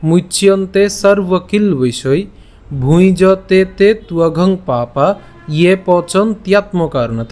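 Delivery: slow, measured verse recitation with drawn-out syllables; pure Sanskrit recitation. Shown as a demonstration. Teacher presents today's Gita verse to the class, pure Sanskrit recitation. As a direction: recite each quarter deliberately, pausing between them. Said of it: "শুদ্ধ সংস্কৃত উচ্চারণে আলোচনা করব".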